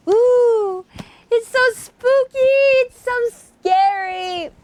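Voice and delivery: high, gushing ooh with a mock-scared lilt; delighted high-pitched mock fear; playful spooky delight. Real, not acted. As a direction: squeal with delicious fright. Gushing over spooky ending.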